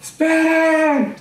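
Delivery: long, high, drawn-out call; shouting wait. Retold or imitated. Woman running back and calling to the pastors, long high shout. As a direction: call out from far away, stretched. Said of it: "viene la misma señora gritando".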